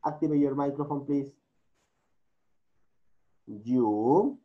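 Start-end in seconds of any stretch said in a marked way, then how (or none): none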